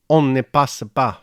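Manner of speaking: a French sentry's shouted order; French soldier's cry. A narrator's reading. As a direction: bark the French command. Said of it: "cried the voice".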